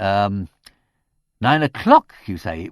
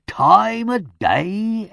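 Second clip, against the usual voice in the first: very slow, higher scornful echo; contemptuous echo; 12 semitones higher, 8 dB louder, much slower than usual. A narrator's reading. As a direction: drag the words out with irritated contempt. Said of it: "with so much contempt and irritation".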